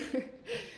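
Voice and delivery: quick soft laugh at herself; short high laugh. Real, not acted. Laughing at herself for yelling at the viewers, soft rising laugh. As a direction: laugh off her own yelling.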